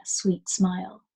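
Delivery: plain reading voice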